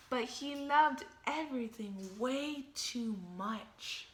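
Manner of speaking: plain reading voice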